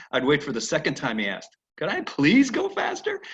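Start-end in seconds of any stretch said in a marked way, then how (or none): 1.8-3.2 very high, slow pleading horse voice; horse begging. Retold or imitated. Horse asking its rider a second time, very high and pleading. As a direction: beg sweetly, drawn out.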